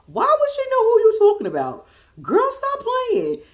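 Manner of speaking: high, loud mock indignation; squealed "quit it" disbelief; exasperated challenge. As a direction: call her out high, slowing at the end.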